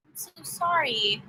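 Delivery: slow, whispered I'm so sorry; hushed, heartfelt condolence; whispered sympathy. Real, not acted. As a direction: breathe the sorry softly and slowly. Hearing a viewer was hospitalised.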